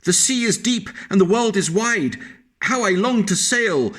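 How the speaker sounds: dreamy wish of a tiny creature, pitched high; wistful little snail, high and yearning. Acted. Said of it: "said the tiny snail".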